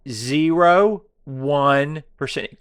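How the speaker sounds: Point, zero one percent, slow and pointed. Shown as a demonstration. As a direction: hammer it home slowly. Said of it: "How can I say it slower so it sinks in?".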